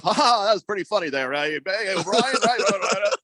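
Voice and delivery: nervous fake laugh, then rapid repeated pleading. Coach covering up after knocking pupil down, mock-nervous cajoling. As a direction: imitate someone hastily laughing off trouble. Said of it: "he realized what he did".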